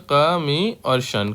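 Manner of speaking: broken into parts, said slowly; कमी और शन, each part slow and separate; slow spelling. Shown as a demonstration. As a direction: spell it out piece by piece. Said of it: "क्या हो जाएगा?".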